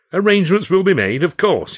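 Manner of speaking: loud, booming impresario selling a vision; grand showman Carno. Acted. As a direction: proclaim it like a proud showman to his company. Carno answers the joke.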